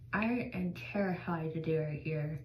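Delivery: ordinary talk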